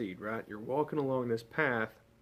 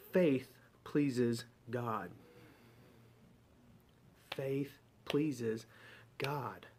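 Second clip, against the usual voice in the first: slow emphasis; much slower than usual. Shown as a demonstration. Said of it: "restated verse, deliberately slow".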